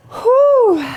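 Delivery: breathless and puffing after the reps; out of breath. Real, not acted. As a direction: talk while catching your breath. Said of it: "mama's out of breath here".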